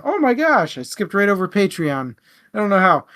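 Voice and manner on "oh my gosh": a quick, high "oh my gosh" at his own slip; flustered realization; sudden realization. Real, not acted. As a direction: catch yourself having forgotten something. Realizes he skipped a plug.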